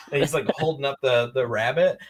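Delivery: ordinary delivery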